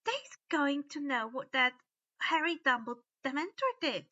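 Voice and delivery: high, urgent elf warning. A narrator's reading. Dobby warns Winky, urgent elf voice. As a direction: caution him sharply. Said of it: "Dobby warned".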